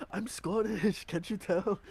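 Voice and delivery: high, hammed-up fake Scottish accent; a bad Scottish accent pushed way up high; insisting he's a Scot in an overdone Scottish accent. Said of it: "He's like".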